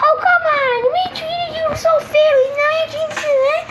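very high-pitched squeaky complainer; indignant protest. Acted. Wronged friends answering back, high indignant protest.

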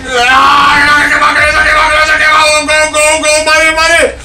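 high frantic shouting; panicked shouting. Real, not acted. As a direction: scream in panic. Panic during enemy attack.

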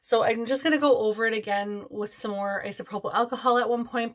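normal talking voice

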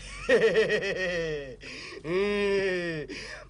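low, drawn-out villain laugh; evil laugh. Acted. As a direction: gloat with a slow wicked laugh. Kẻ ác cười đắc thắng. (tiếng cười gian ác đắc thắng)

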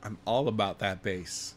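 low, soft playful bass; mock bass voice. Host answering tease about no bass, playfully low voice. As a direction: drop your pitch for the joke. Plays along with the bass joke.

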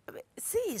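hushed whispered aside; whispering into the phone. Acted. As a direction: keep it a breath, unvoiced.

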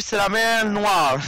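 slow, deadpan "C'est la mer Noire"; lightly voiced film character, very slow; mock movie line. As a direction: drawl it like a famous film quote. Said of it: "j'aurais répondu".

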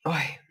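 sudden sharp sneeze; sneezing. Real, not acted. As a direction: let out a real sneeze. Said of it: "qué estornudo más chungo". (estornudo repentino)

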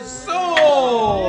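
loud, drawn-out sold; excited. Real, not acted. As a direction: stretch it out triumphant. Auction close.

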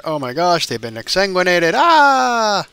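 hammed-up alarm, ending in a high, loud "ah"; mock horror. Player finds the bodies still in the house, mock horror. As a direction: play-act shock, rising into a mock scream.

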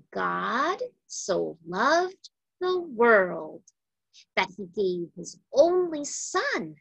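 ordinary delivery

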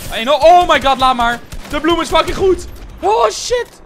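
very loud, high outburst; real gamer shouting; shocked yelling. Real, not acted. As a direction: scream in disbelief mid-game. Sudden shock in the fight.

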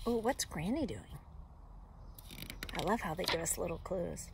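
ordinary delivery

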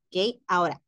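ordinary talk, nothing marked

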